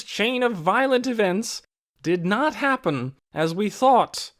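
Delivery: Mary's public address. A narrator's reading. Mary speaking out to the village meeting, firm and carrying. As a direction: address the whole meeting firmly.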